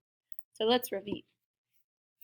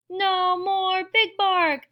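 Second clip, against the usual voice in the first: loud, high, drawn-out cheer; 9 semitones higher, 6 dB louder, much slower than usual. A narrator's reading. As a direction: cheer like a whole delighted crowd. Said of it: "the crowd cheered".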